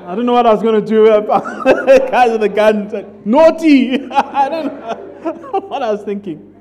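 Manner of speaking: laughing at his own bravado; chuckling through the words. Real, not acted.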